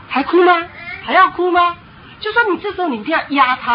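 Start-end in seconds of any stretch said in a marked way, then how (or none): 0.1-1.7 loud, high, rising warning questions; threatening challenge. Acted. Parent pretending to fetch the stick, loud threatening questions. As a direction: dare the child to keep crying. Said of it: "就假装走过去要去拿了".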